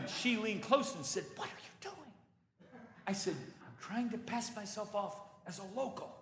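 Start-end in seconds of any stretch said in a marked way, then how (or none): 1.4-2.1 a higher, much softer tense question; wife's hushed hiss. Retold or imitated. Wife leans close, hushed and embarrassed question. 3.4-6.1 a softer, higher whispered answer; hushed reply. Retold or imitated. Husband whispers back to his wife, hushed.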